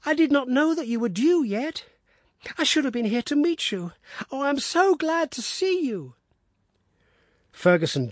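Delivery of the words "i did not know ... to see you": put-on high eager boyish voice; excitable boy. A narrator's reading. Son welcoming father home, high and eager. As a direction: gush it out like an adoring young lad.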